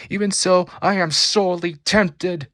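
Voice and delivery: loud, high seething anger; barely restrained fury. Acted. Storm raging at the thugs, loud and tempted. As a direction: fight the urge to strike. Furious hero in fight.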